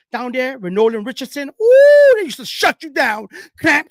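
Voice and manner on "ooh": high, loud excited ooh. Real, not acted. Recalling forty minutes of hell, loud high ooh. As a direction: ooh at a great memory. Remembering fierce defense.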